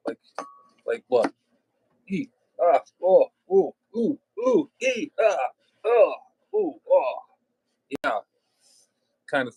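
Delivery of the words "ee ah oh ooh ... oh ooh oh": high, hooting oohs and ahs, much higher than his voice; imitated swinging grunts. Mocking a friend's golf swings with high hoots and grunts. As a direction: mimic the effort noises of a clumsy golf swing. Imitating someone whacking bushes.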